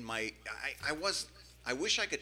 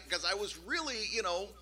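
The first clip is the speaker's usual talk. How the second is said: usual speaking voice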